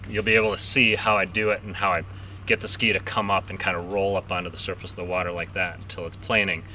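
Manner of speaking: ordinary delivery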